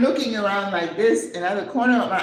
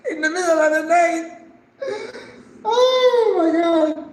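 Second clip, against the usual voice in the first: high, loud, half-laughing wail; comic cry of despair; mock wailing; 11 semitones higher, 6 dB louder than usual. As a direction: wail in hammed-up dread, pitch soaring. Recalling dread at riding with a stranger.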